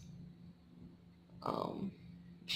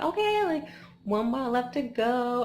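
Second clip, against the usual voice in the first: high, loud burst of relief; sudden hopeful lift in the voice; excited thought; 5 semitones higher, 5 dB louder than usual. Retold or imitated. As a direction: cheer yourself on, pitched up. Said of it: "I thought".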